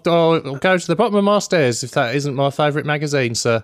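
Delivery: high-pitched, over-the-top cabbie caricature; hammed-up "blimey, governor" cabbie; taxi driver impression. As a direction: gush like a star-struck mock taxi driver. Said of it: "taxi driver. That's how they all talk".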